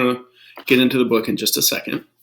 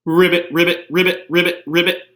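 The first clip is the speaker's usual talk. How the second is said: high, loud playful ribbits; cheerful pretend frog croak; Piggy croaking; 6 semitones higher, 5 dB louder than usual.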